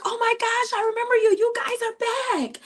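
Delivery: gushing staff impression. Staff welcoming the family back, very high gushing imitation. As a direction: imitate thrilled staff at the top of your range. Voices staff second year greeting.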